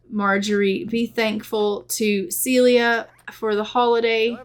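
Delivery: usual speaking voice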